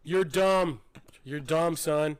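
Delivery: hammed-up harsh father; mock berating dad. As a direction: insult the son at full volume, jokingly. Acting a dad insulting his son.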